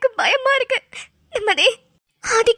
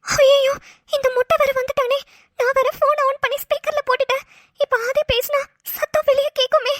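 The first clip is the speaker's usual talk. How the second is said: usual speaking voice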